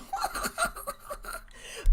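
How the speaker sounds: high breathy burst of laughter; airy falling giggle; giddy laugh. Real, not acted. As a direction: laugh in delighted disbelief. Trade pays off live.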